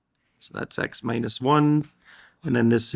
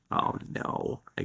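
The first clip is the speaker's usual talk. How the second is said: whispered oh no; 10 dB quieter than usual. Real, not acted. Dismayed under breath.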